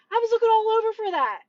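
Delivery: high, quick exasperated complaint; retold angry grumble, rushed; mock mad. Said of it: "He was so mad".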